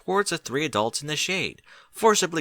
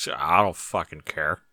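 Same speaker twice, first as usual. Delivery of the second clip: vaguely threatening; 7 semitones lower than usual. A narrator's reading. Barry brushing off Derpy's concern, low slow menace. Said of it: "in a vaguely threatening tones".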